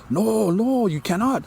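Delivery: high urgent no no; pleading wife. Retold or imitated. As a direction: hold him back in a panic. Wife restraining husband.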